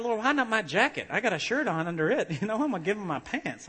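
pleading protest. Retold or imitated. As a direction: plead your case with comic disbelief. Acting out protest to the Lord.

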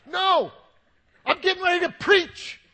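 indignant, high full-volume holler; hollered shout. Retold or imitated. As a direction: yell back upstairs in re-enacted outrage. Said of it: "I hollered back".

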